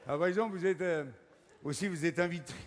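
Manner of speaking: normal talking voice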